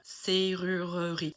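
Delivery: serrurerie drawn out slowly, working at each R; laboured French R's. Shown as a demonstration. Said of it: "Can't do it".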